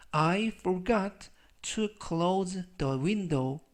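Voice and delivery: slow model sentence. Shown as a demonstration. Teacher reading the example sentence aloud, very slow. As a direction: Keep your belongings in the locker read very slowly. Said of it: "slow model reading of sentence".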